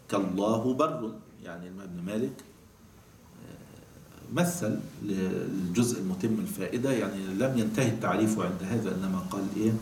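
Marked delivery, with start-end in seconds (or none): none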